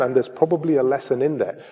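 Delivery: ordinary talk, nothing marked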